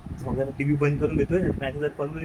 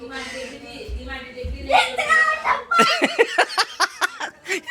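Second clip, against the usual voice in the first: laughing; 13 semitones higher than usual. Real, not acted. Peekaboo game with the child. (खळखळून हसणे)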